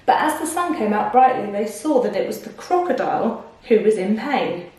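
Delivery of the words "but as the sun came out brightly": plain reading voice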